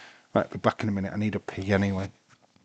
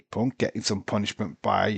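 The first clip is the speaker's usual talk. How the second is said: ordinary talk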